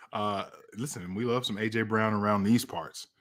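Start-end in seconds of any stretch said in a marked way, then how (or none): none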